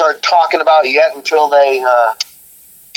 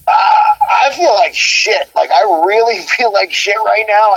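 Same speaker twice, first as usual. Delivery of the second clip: slow, higher, groaning sick voice; groggy sick complaint; 6 semitones higher than usual. Retold or imitated. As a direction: moan it out like you feel awful. Sick singer retells telling bandmate.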